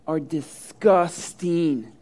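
DISGUSTING drawn right out, syllable by syllable; emphatic DISGUSTING. Retold or imitated. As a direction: stretch DISGUSTING very slowly for emphasis. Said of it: "I emphasized the word disgusting".

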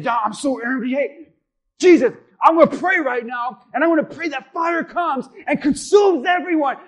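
rising to a furious shout; acting out an enraged disciple, loud and high; angry John. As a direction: build from grumbling to yelling for fire. John angry, wants fire on everyone.

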